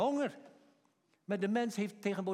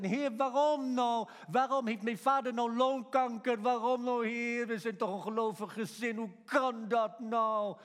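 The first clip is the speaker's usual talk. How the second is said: anguished questioning; 4 semitones higher, 3 dB louder than usual. Acted. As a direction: plead upward, demanding to know why. Acted anguished prayer of questioning believer.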